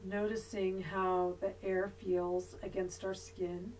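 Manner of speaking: soft, unhurried yoga nidra voice with long pauses; guided meditation. Real, not acted. Yoga teacher leading resting students through body awareness, guided meditation voice. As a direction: guide them gently into body awareness, leaving long silences.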